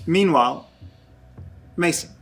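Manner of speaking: dreamy scene narration. Acted. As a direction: let the words float like drifting in nothing. GM narrates Mason's drifting dream.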